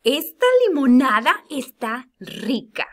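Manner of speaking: teacher showing an emotional sentence; excited exclamation. Shown as a demonstration. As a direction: savor it with enthusiasm. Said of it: "La número tres dice".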